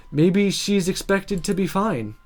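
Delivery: usual speaking voice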